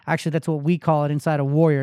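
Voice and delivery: usual speaking voice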